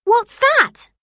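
put-on girl surprised by the word yeti. A narrator's reading. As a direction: gasp it out, amazed. Emma asks, puzzled.

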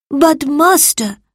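high, slow plea to a master; pleading protest. A narrator's reading. Salvius to demanding Marcus, pleading protest.

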